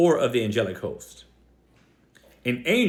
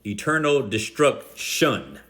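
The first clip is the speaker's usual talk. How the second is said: "destruction" drawn out, stress on its last syllable; quoted emphasis; slower than usual. Retold or imitated. Preacher quotes his opponent's emphasis, "destruction" slow and stressed on its ending. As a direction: break up "destruction" slowly, leaning on the -tion. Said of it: "His emphasis, not mine".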